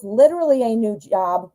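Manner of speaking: normal talking voice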